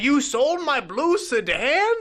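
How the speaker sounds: high, slow incredulous whine; outraged disbelief, hammed up; mock-offended question. Mocking Aborn's outrage.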